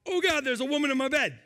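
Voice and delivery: high, fast mock panic; a man finding a stranger in his bed, hammed-up. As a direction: rush it out in comic alarm.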